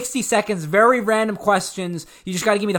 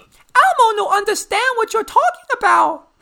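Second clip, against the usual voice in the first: high, slow Elmo squeak; squeaky little monster, third person; 13 semitones higher than usual. Retold or imitated. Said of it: "he speaks in the third person".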